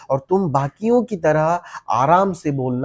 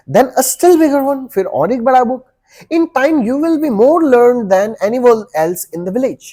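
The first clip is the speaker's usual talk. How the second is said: rising enthusiasm; 7 semitones higher, 5 dB louder, slower than usual. Acted. Teacher promises bright future.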